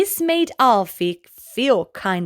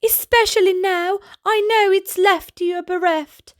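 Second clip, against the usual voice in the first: calling after; 9 semitones higher than usual. Acted. Alfie calls after Bertie.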